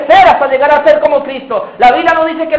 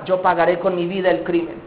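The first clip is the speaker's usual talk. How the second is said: resigned answer; 7 semitones lower, 9 dB quieter than usual. Acted. Accused answers at trial.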